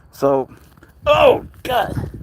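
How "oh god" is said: high exasperated "oh, God"; startled oh God. Real, not acted. Owner exclaims as cat starts again, exasperated. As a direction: caught off guard, blurting it out.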